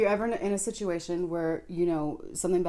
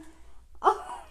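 high laugh. Real, not acted. Laughs at own story.

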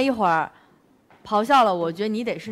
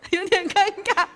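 embarrassed laugh through the words; giggling, pitch jumping up; 9 semitones higher than usual. Real, not acted. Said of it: "他纹身掉了".

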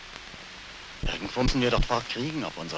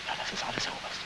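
whisper; 7 dB quieter, faster than usual. Acted. Quiet closing to colonel.

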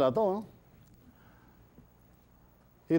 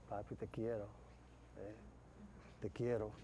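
much softer, low, intimate murmur; lover's whisper; 9 semitones lower, 20 dB quieter than usual. Acted. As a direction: breathe it across the table. (la novia susurra al amante, muy bajito)

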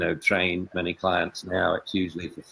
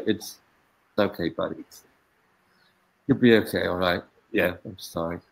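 soft, hushed comforting of a sad dog; tender comfort to dog; 3 dB quieter than usual. Acted. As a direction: kneel down and soothe the dog gently. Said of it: "play acting".

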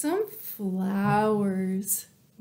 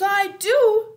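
much higher, louder yes; eager dog; 10 semitones higher, 8 dB louder than usual. Acted. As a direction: blurt it out excitedly. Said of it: "said Dog".

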